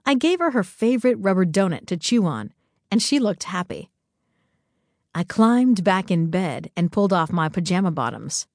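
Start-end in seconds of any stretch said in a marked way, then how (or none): none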